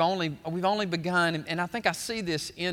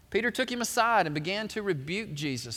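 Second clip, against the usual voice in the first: ordinary talk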